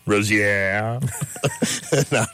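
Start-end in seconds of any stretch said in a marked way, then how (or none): 0.1-1.0 a quizzical French Rozier, Ro-zee-ay, rising at the end; mock French accent. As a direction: toss the name off like a French waiter. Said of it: "everything's gonna become that French accent".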